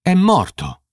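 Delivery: sharp high cry of shock; outraged shock at the corpse; shocked outrage. A narrator's reading. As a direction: blurt it out in shocked disgust. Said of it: "il volto una maschera di sdegno".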